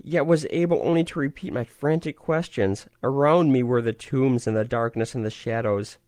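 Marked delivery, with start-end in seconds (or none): none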